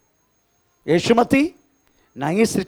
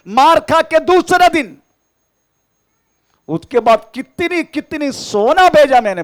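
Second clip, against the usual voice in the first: higher and louder, hammering each phrase to the church; full-force preaching; 13 semitones higher, 7 dB louder than usual. Acted. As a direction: testify it out to the congregation, loud and high. (ऊँची और ज़ोरदार आवाज़ में प्रचार)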